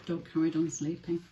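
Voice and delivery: plain reading voice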